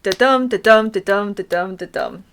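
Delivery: "Da-dum" with a light "da" and a punched "dum"; each "dum" stressed, each "da" quick and unstressed; iambic rhythm demo. Shown as a demonstration. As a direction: chant "da-dum" as a steady weak-STRONG beat. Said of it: "one unstressed syllable followed by a stressed syllable".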